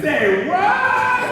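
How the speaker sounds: very high, loud, stretched-out shock; mock-astonished high yelp; startled disbelief. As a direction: squeal it in comic disbelief. Acting out startled passengers' reaction.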